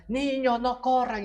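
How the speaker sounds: high, loud call to stop running; mock parent shout; shouting at kids. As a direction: yell across the room at children. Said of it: "el mismo grito excita".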